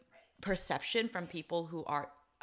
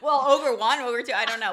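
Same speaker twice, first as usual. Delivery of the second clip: louder and higher, laughing through the names; 5 semitones higher, 11 dB louder than usual. Real, not acted. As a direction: giggle out the nicknames. Laughing while naming the ogres.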